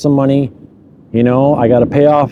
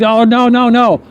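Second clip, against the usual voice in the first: retold panic, pitched much higher; mock alarm, high and quick; panicked denial; 8 semitones higher than usual. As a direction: blurt it out high and frantic. Retold panic at the cop's order.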